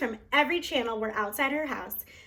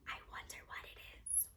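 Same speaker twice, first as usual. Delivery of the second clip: breathy far-off whisper; a curious aside, whispered; 23 dB quieter than usual. Acted. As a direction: wonder to yourself in a whisper.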